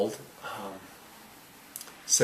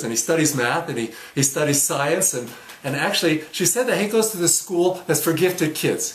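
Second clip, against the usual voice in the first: proud, rising louder and higher; acted proud excitement; excited fatherly boasting; 5 semitones higher, 5 dB louder than usual. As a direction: brag in amazement about your gifted kids.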